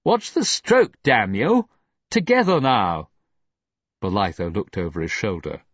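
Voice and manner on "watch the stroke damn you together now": high, loud angry shout. A narrator's reading. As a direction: roar at the rowers. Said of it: "Egmont shouted".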